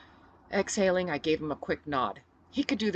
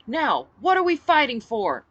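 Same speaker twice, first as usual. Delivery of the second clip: rousing speech; 8 semitones higher, 9 dB louder than usual. Acted. Speech over cheering crowd.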